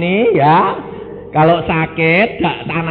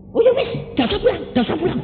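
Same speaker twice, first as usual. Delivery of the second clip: high, fast hasty retreat; sister backing down; 14 semitones higher, much faster than usual. Retold or imitated. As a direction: quickly drop the demand, flustered. Sister quickly changing her mind.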